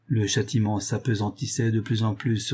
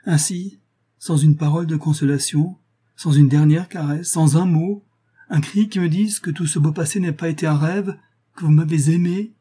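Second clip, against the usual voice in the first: high, louder desperate plea; impassioned outcry; 6 semitones higher, 5 dB louder than usual. A narrator's reading. Julien begs his lover for one last word, crying out. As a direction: plead with raised pitch and force. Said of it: "s'écria Julien".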